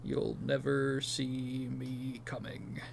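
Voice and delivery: slow, soft mock menace; intimidating villain. Quoting the villain's hijacked TV clips, slow mock intimidation. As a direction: imitate a psychotic villain, drawing the threat out quietly. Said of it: "like, the most intimidating".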